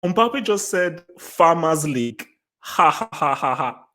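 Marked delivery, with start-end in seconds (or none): 2.6-3.8 hearty ha-ha-ha laugh; real laugh. Real, not acted. Laughing at Mbappe's post.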